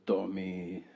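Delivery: lightly imitated Peruvian mother calling his name; Peruvian accent. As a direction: say Tommy the way a Peruvian mom does. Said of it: "Why does she talk so fucking weird".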